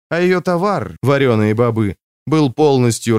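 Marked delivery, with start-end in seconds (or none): none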